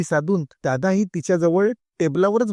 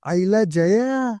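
excited call; 4 semitones higher, much slower than usual. A narrator's reading. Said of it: "विजय आतून ओरडला".

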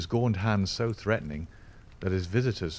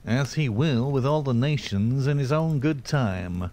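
higher, louder nasal character voice; pious nasal pronouncement, pitched up; 5 semitones higher, 5 dB louder than usual. A narrator's reading. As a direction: intone it through the nose. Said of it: "said the other in a nasal voice".

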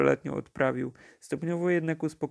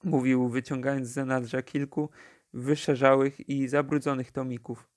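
plain reading voice

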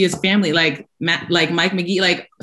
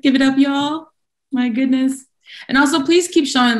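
higher, louder call to the crowd; excited hype; 8 semitones higher, 3 dB louder than usual. Real, not acted. Host rallying the audience to cheer the laureates, excited. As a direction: pump up the room for the winners.

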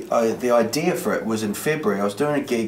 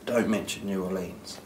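inner voice; 2 semitones lower, 8 dB quieter than usual. Retold or imitated. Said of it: "my head said".

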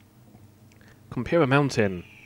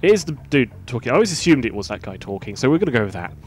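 ordinary delivery

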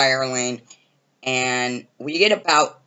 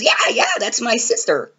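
squeaky eager yes-yes; imitating Justin; 8 semitones higher than usual. Justin insists, quoted.

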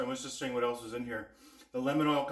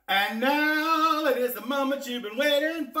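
announcer voice; 11 semitones higher, 7 dB louder than usual. Acted. Big announcement of tasting.

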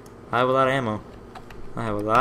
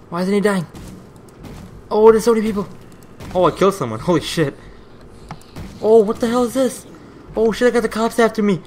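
panicked yelling; 9 semitones higher, 5 dB louder than usual. Real, not acted. Ambushed by gang and cops.

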